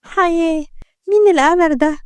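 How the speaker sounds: put-on parroting the girl with a sneer; imitating the girl. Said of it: "وبتقلد صوت البنت". (تقليد ساخر لصوت البنت بصوت أعلى)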